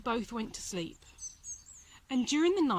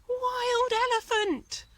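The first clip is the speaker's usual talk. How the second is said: much higher, louder, slow terrified cry; panicked shout; 12 semitones higher, 5 dB louder, slower than usual. A narrator's reading. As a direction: scream it as a fleeing crowd.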